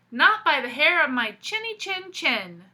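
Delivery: loud defiant holler from inside; hollering pig. Acted. Pig refusing the wolf, loud defiant holler. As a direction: bellow it back through the door. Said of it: "he hollered".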